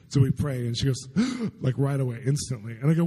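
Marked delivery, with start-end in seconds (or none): none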